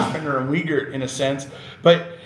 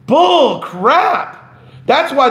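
loud, higher outburst of real indignation; fired up; 8 semitones higher, 5 dB louder, much slower than usual. Real, not acted. Defending supporters from critics, a loud higher indignant outburst. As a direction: spit it out, genuinely fed up. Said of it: "got a little bit my Irish up there".

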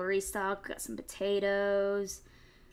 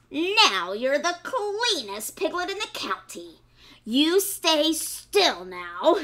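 higher, louder sly fox, slowing at the end; 6 semitones higher, 8 dB louder, slower than usual. Acted. Fox pleased with the scrubbed piglet, sly and loud. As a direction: play the fox who wants his dinner. Fox voiced in read story.

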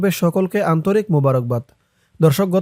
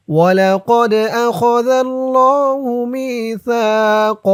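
slow, higher chanted recitation; Quran recitation; 6 semitones higher, 3 dB louder, much slower than usual. Shown as a demonstration. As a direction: intone it slowly like tajweed. Verse recitation.

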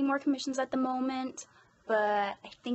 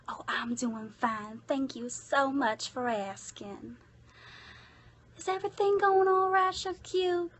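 put-on shy Southern accent. Voicing a requested character greeting viewers, sweet shy Southern accent. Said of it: "sweet, shy Southern accent".

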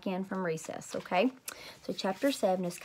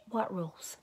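softer, close to the ear; 6 dB quieter than usual. Acted. Teacher leaning to Junie B's ear, a quiet question.

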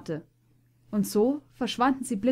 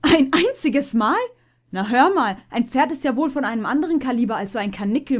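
amused disbelief; 3 semitones higher, 7 dB louder than usual. Acted. Puma mocking after laughing.

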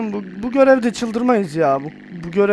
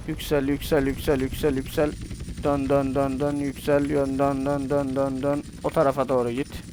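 softer, lower murmured chant to the helicopter; quiet coaxing; 5 semitones lower, 9 dB quieter than usual. Real, not acted. Gamer softly urging the helicopter to rise and turn, quiet coaxing chant. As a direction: coax it along under your breath.

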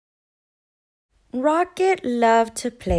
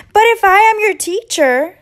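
higher, louder little bird cutting in; bird interrupting; 9 semitones higher, 12 dB louder than usual. A narrator's reading. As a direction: jump in eagerly as the tiny bird. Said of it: "the bird interrupted".

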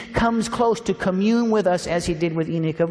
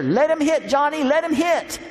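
high, lightly raised call across the field; mock shout at the pitcher; 8 semitones higher than usual. Old lady shouting to the pitcher, playful high call. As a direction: holler it like a spry old lady coaching. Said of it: "she would shout at the pitcher".

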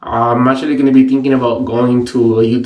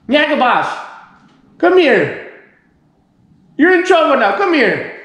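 high call with falling endings; calling the dog; 11 semitones higher than usual. Real, not acted. Owner to dog in trouble, high-pitched calling. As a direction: call a naughty pet in a high voice.

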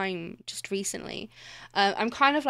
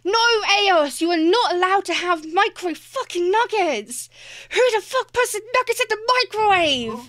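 much louder and higher, exaggerated indignation; mock outraged yell; 9 semitones higher, 7 dB louder than usual. Real, not acted. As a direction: yell at the viewer in playful outrage. Scolding a chat redeem voice.

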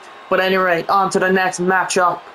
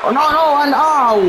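excited shout; 6 semitones higher than usual. Real, not acted. Live wrestling play-by-play.

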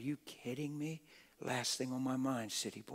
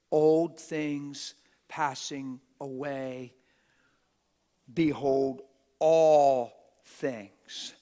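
slow, loud proclamation building on all things; booming scripture; 11 dB louder, much slower than usual. Real, not acted. Preacher proclaiming scripture to the church, booming. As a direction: thunder it out to the congregation.

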